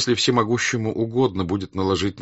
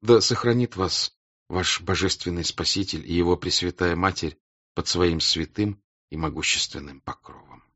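plain reading voice

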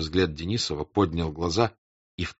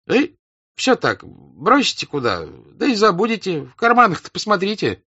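high, chiding servant; a servant telling his master to check his pockets, high and chiding; servant's voice; 10 semitones higher, 5 dB louder than usual. Acted. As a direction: pitch it way up and scold lightly. Lavrushka chides master, high voice.

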